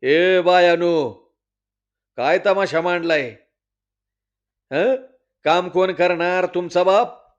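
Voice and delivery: loud, scolding bark at the women; headman's angry shout; angry yell. Acted. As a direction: drive the women back to work. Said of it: "तसं पाटील वरडलं".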